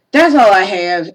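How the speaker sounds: ordinary delivery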